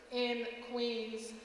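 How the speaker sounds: usual speaking voice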